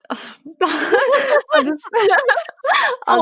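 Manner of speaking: breaking into giggles mid-sentence; laughing shyly; giggly laugh. Real, not acted. Giggling while describing crush.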